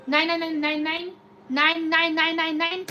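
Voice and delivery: ordinary talk, nothing marked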